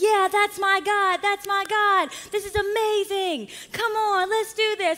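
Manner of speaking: high, loud cheering crowd; excited. Acted. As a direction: whoop it up like a celebrating crowd. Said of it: "They're excited".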